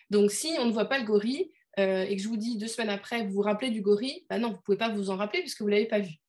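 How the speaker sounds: normal talking voice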